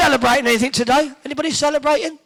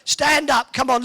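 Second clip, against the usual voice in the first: usual speaking voice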